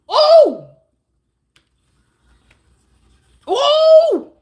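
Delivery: a very high, loud ooh; shrieking ooh of mock astonishment; high squeal. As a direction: squeal like you're stunned. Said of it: "You say".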